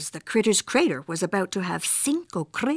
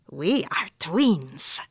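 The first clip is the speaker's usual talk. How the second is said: slow and deliberate; 3 semitones lower, much slower than usual. Acted. Slow reveal in mirror.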